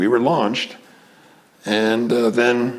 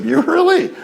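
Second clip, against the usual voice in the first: a much higher, incredulous question; be floored by it; amazed disbelief; 14 semitones higher than usual. Retold or imitated. Hearing his friend is a famous tennis teacher, amazed.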